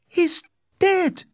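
very high, grief-struck; anguished cry. A narrator's reading. Lady Capulet sees Tybalt dead, crying out. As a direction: wail it in a very high voice. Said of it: "she cried".

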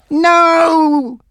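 high desperate scream. Acted. Bob to Frankie, screaming no. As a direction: cry out in terror. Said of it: "Bob screamed".

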